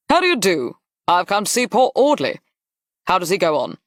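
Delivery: high, hearty greeting from a Duke; brisk Duke. Acted. Duke calling on a wounded officer, brisk and high.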